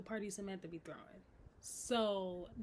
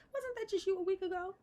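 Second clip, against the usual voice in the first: much higher, pointed mocking retort; sarcastic jab; 9 semitones higher, 4 dB louder than usual. Real, not acted. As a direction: throw her own words back at her.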